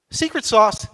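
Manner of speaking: normal talking voice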